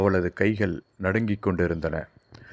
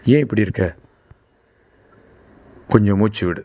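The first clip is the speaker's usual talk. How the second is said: louder, urgent plea to calm her; urgent concern; 2 semitones higher, 6 dB louder, faster than usual. A narrator's reading. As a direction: raise the voice in worry for her. Siva urges crying Veni. (கவலையுடன் உரக்க அவசரமாக)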